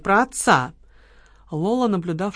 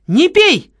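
sharp alarmed cry, much higher and louder; 10 semitones higher, 8 dB louder than usual. A narrator's reading. Lola cries out to Kamila to stop, a sharp shout. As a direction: stop her drinking with a sudden shout. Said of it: "вскрикнула Лола".

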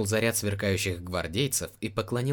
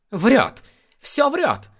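higher, louder gasping outcry; breathless shout; 9 dB louder, much slower than usual. Acted. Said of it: "задыхаясь, выкрикнул истец".

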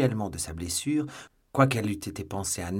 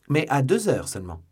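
plain reading voice

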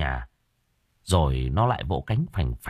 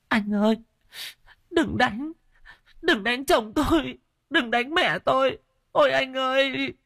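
very high, frantic pleading cries; panicked begging. Acted. Panicked widow begs ghosts not to beat her husband, frantic high cries. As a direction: beg them to stop in terror. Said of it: "hốt hoảng dãy nảy lên".

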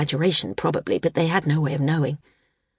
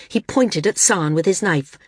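plain reading voice

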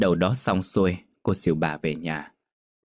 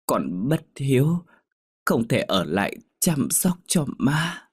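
higher, slow, pleading last breath; dying plea; 5 semitones higher than usual. A narrator's reading. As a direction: beg forgiveness with fading strength, slowly. Dying daughter begs mother's forgiveness. (con gái hấp hối van xin má tha lỗi, chậm và cao giọng)

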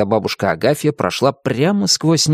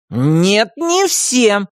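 stubborn; 10 semitones higher, much slower than usual. A narrator's reading. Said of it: "не уступал ей Тим".

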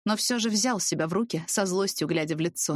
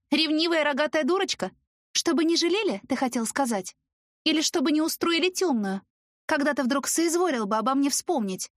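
bitter sarcasm; 8 semitones higher than usual. A narrator's reading. Heroine's bitter sarcastic outburst.

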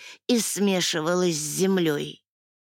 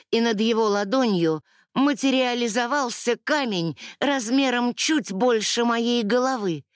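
usual speaking voice

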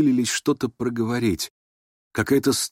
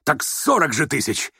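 insistent exclamation, pitched up; excited cry; 5 semitones higher than usual. A narrator's reading. Rogozhin haggling before the whole company, excited cry. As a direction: cry it out as an indignant correction. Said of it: "закричал Рогожин".

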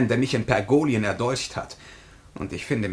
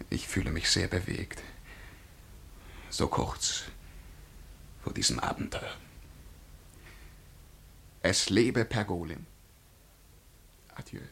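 tears in the voice; 3 semitones lower, 8 dB quieter than usual. Acted. Jing Jong bidding the Parisian farewell, tearful and soft. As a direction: voice breaking with emotion, held back. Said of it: "hatte er zuletzt Tränen in der Stimme".

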